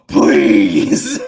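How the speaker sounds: loud high gasp, then desperate plea; re-enacted frantic outburst; losing their mind. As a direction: beg at full volume. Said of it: "I lost my mind".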